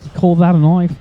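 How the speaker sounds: Crocodile Dundee. Retold or imitated. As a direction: punch THIS in an Australian accent. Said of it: "Crocodile Dundee references with him".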